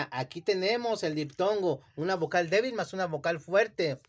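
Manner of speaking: ordinary talk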